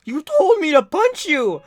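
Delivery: loud, high exasperated protest; indignant yelling. Retold or imitated. Storyteller to the mad classmate, indignant yelling. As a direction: yell it back in hammed-up outrage. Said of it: "he was also mad at me".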